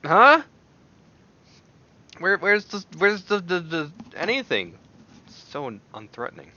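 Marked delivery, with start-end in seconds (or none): 0.0-4.8 loud high bewildered search; puzzled outcry. Real, not acted. Vlogger searching for the missing flavor, loud puzzled outcry. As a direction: call out where did it go.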